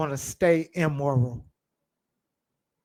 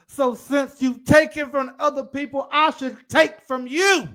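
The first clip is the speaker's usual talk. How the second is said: loud, rising higher on every phrase; preaching at full force; 7 semitones higher, 5 dB louder than usual. Acted. Preacher voicing a sinner who justifies evil, loud and climbing. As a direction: hammer the excuse home, climbing in pitch.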